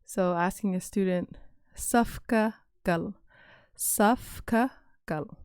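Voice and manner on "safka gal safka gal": Safka gal said slowly and clearly; slow model. Shown as a demonstration. Teacher modeling line-up phrase for new teachers. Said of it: "easier to pronounce".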